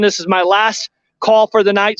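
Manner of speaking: ordinary talk, nothing marked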